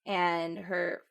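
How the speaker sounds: ordinary talk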